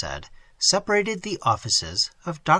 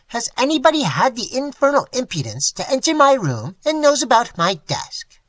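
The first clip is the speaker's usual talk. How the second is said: higher, reedy and mocking; thin sarcastic voice; 9 semitones higher, 4 dB louder than usual. A narrator's reading. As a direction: sneer the question from the doorway. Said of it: "in his thin, highly sarcastic voice".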